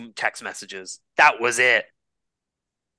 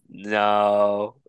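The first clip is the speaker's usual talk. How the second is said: ordinary delivery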